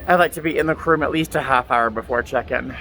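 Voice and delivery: normal talking voice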